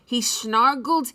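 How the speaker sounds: ordinary delivery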